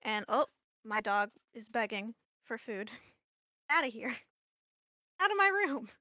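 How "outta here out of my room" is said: raised voice, shooing out; loud, high shoo at the dog. Real, not acted.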